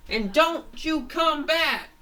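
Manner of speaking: high, loud, slow threat; villain's shout. Acted. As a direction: yell it after them as they flee. Villain yells after fleeing heroes.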